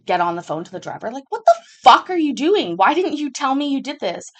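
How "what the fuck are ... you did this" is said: higher, louder angry demand; angry outburst. Retold or imitated. Angrily yelling at the driver over the phone. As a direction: chew out the driver who hid it.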